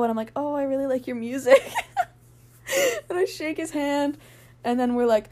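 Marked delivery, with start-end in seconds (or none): 0.3-4.2 higher, louder, laughing through it; giggly fan. Retold or imitated. Telling the idol she likes his music, giggling. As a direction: gush to the star through a giggle.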